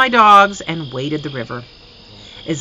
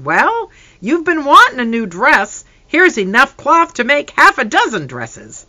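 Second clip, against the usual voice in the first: higher and louder, a cheerful father; hearty father showing off his gift; father's booming voice; 9 semitones higher, 6 dB louder than usual. Acted. Father's booming voice giving gifts.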